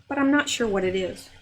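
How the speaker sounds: ordinary talk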